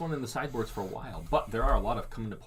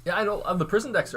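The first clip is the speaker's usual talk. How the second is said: amused; 11 semitones higher, 6 dB louder than usual. Real, not acted. Still laughing at joke.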